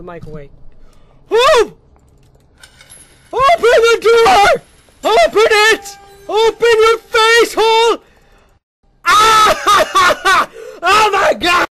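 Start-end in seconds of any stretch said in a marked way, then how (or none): 1.3-8.0 high, very loud desperate yelling; screaming. Real, not acted. Gamer screaming at a door to open. 9.0-11.7 high, loud, slow relieved shouts with laughter; ecstatic shouting. Real, not acted. Gamer escaping and shouting praise in relief.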